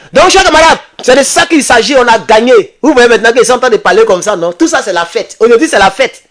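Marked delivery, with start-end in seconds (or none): 0.1-3.8 raised, proud speech to comrades; rally the comrades, pitch up; rallying address. Real, not acted. Political livestream address to comrades. (discours militant exalté)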